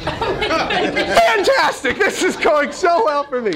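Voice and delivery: drawn-out, high, mock-delighted fantastic; dry ironic cheer at a bad omen; sarcastic. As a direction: stretch it out with sarcastic glee. Sarcastic aside after awkward remark.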